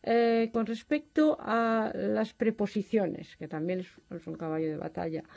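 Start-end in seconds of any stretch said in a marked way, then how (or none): none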